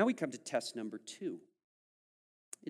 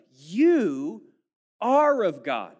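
"You" louder and higher, then slow; stress on You; 5 semitones higher, 12 dB louder, much slower than usual. Shown as a demonstration. Preacher repeating verse four with emphasis on you. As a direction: lean hard on "You". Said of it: "is emphatic".

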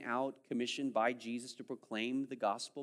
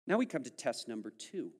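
ordinary delivery

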